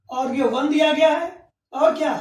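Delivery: ordinary talk, nothing marked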